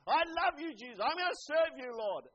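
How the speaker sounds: loud, high-pitched acted worship cry; mock-devout declaration, dropping on the last word; hollow profession of devotion. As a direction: proclaim it with big Sunday fervour, for show. Mock quote of insincere Sunday worshippers.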